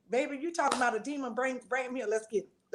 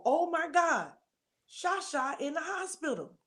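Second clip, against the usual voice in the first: sudden realization; 6 semitones higher than usual. Retold or imitated.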